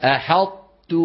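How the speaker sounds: أحطت with the ط held back, no echo; tajweed demo. Shown as a demonstration. Teacher demonstrating أحطت to students. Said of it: "انظروا، لا تحكوا أنتم".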